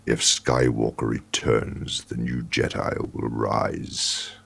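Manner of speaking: deep slow menacing Supreme Leader voice; Snoke impression. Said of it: "do a Snoke impression with that voice of his".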